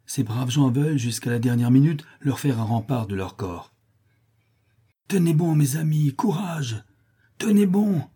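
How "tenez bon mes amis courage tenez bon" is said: higher, urgent rallying cry; rally the defenders to hold on; shouting. A narrator's reading. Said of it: "ne cesse de crier Don Blas". (crié pour encourager ses hommes)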